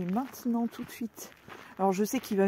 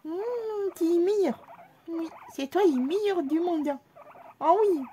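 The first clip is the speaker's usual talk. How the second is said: much higher, sugary praise for a pet; fond baby voice; high loving pet talk; 9 semitones higher than usual. Acted. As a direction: dote on him. Praising the rabbit sweetly.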